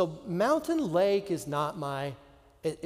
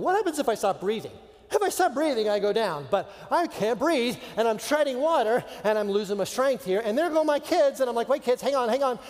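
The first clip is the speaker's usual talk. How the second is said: higher, urgent, acted alarm; rising panic; 5 semitones higher, 4 dB louder than usual. Swimmer who can't breathe, losing his kids, panicking. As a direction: let the panic climb line by line.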